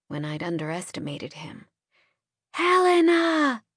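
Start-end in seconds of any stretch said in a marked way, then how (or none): 2.5-3.6 high drawn-out scream of a name. A narrator's reading. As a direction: scream her name in despair. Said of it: "I screamed one last time".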